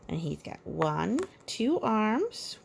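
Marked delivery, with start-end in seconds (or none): none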